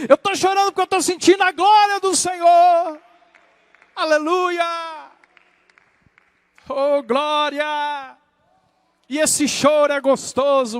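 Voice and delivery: loud, high-pitched, driving sermon; fervent preaching. Real, not acted. Preacher urges congregation to weep before God, fervent preaching. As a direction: preach it at full force in long rolling bursts.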